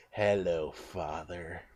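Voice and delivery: low, soft, menacing dummy voice, Father trailing off; sinister Slappy greeting. Pretend. Fan redoing Slappy's line, low and menacing. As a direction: purr it like a creepy ventriloquist dummy. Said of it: "I would rather you have been like".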